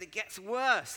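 high, loud warning of worse news; hammed-up panic; mock dread. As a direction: wail it like the plot thickens. Friend's ominous follow-up.

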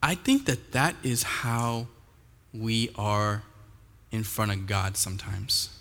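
ordinary talk

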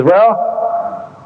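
high-pitched lady explaining her doubt; retold woman's voice, slowing on the last words; imitated woman objector. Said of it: "And she says".

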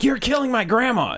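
high, indignant accusing voice; shrill-free mock outrage, pitched well up; mock offended scold. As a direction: play the outraged stranger, high and shocked. Voicing an offended Facebook scold.

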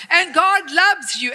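ordinary talk, nothing marked

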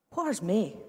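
a Dundee man asking after his wife, lightly voiced in broad Dundee accent. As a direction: drop into a broad Dundee accent for a quick question. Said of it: "in this wonderful Dundee accent".

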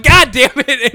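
high, loud cry of dismay; exasperated yell. Retold or imitated. Storyteller recoiling at his naked buddy, exasperated yell. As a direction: burst out loud in mock horror. Shock at accidental sight, retold.

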